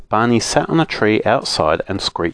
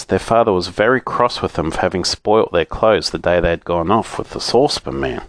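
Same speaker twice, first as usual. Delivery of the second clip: plain reading voice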